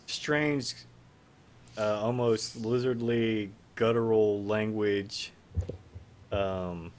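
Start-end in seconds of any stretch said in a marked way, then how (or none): none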